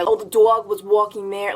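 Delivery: exaggerated Long Island vowels; thick Long Island dog and walking; Long Island accent. Shown as a demonstration. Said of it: "no one wants to hear".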